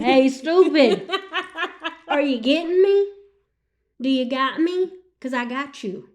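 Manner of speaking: God. Retold or imitated. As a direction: comically chide someone, easing on the last words. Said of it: "this is how me and God talk".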